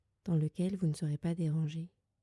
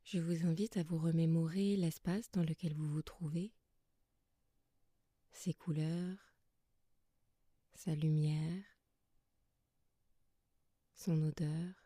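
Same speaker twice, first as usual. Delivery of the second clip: soft, calm yoga nidra guidance with long pauses; guided meditation; 5 dB quieter than usual. Acted. Yoga teacher settling listeners lying under blankets, soft guided meditation. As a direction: guide them gently into rest, leaving long silences between phrases.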